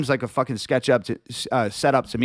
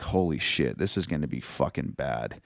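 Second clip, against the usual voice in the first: much lower and softer, muttered; hushed dread; 11 semitones lower, 7 dB quieter than usual. Retold or imitated. Realising the cops think they have drugs, muttered in quiet dread.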